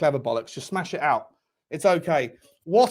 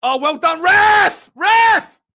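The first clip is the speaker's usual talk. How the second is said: high, loud sarcastic yell at the ref; furious shout; 13 semitones higher, 9 dB louder, much slower than usual. Real, not acted. Fan screaming sarcastically at the referee, angry shout.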